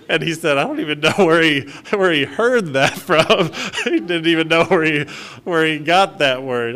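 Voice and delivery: laughing. Real, not acted. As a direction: chuckle your way through it. Laughing at nephew's words.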